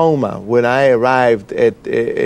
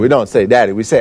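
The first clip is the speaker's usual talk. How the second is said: usual speaking voice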